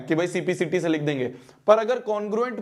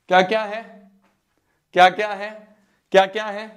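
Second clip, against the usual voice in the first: loud prompting; 2 semitones higher, 6 dB louder, faster than usual. Real, not acted. Prompting class for answer.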